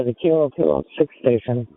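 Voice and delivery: usual speaking voice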